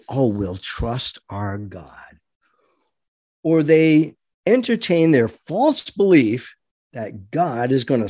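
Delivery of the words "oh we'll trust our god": slow, softer mock devotion; lazy pious shrug, drawn out; mock complacent piety. Mocking believers who sit back, soft slow mock piety. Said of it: "They just sit back and say".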